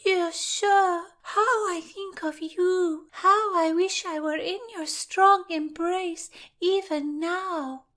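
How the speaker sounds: mock a swooning girl, high and sweet; simpering girl voice. A narrator's reading. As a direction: Rutland mocking a lovesick girl, high simper. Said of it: "in the high voice of a simpering girl".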